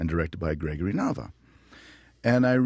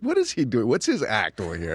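baffled casting director; 5 semitones higher, 3 dB louder than usual. Retold or imitated. Casting calls his agent, baffled.

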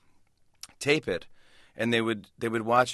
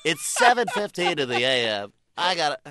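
shock jock DJ; 6 semitones higher than usual. Retold or imitated. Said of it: "They're like".

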